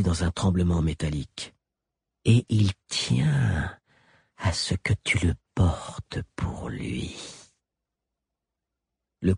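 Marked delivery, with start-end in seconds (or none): 2.2-7.2 slow menacing whisper; sinister whisper. A narrator's reading. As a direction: breathe it into her ear. Galien whispers the threat.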